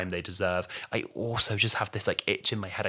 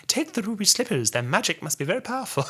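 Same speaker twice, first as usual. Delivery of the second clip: high, theatrical storybook delivery; mock-grand, much higher than usual speech; hammed-up film line; 11 semitones higher than usual. Reviewer parodying the magical slippers speech, hammed-up grandeur.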